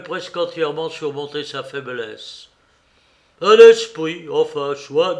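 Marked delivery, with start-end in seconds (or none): 3.4-5.1 forceful Silver, punching the first words; Silver reasoning aloud. Acted. Silver conceding a ghost to his men, loud bluff. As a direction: concede it loudly, as Silver would.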